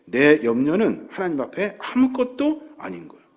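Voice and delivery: ordinary delivery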